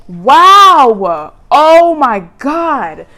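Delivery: loud, high, drawn-out wow of delight; real gasping excitement at the cards; excited outburst. Real, not acted. Reader gasps at her own cards, loud and thrilled.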